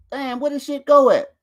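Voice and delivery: ordinary talk, nothing marked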